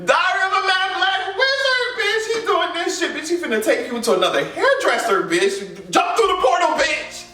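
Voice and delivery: high, squealing comic outburst; mock-outraged, high-pitched roast; hammed-up hysterics. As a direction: shriek the joke through laughter. Hammed-up riff on the joke.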